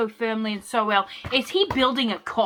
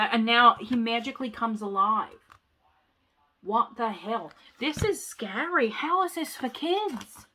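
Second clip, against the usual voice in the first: ordinary delivery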